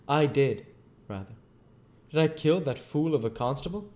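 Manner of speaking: a bitter, driven scientist telling his tale; lightly voiced, brooding fugitive; a hint of restless menace; Invisible Man.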